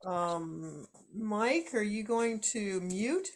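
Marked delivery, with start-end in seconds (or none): none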